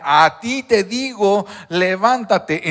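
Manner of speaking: loud, slow "levántate" stretched out; slow commanding call. Acted. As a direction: proclaim it slowly, with authority. Jesus's command retold with force.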